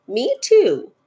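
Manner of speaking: a hint of a small animal's voice; young kangaroo. A narrator's reading. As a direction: pipe up in agreement with mother. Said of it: "the young kangaroo in her pouch said".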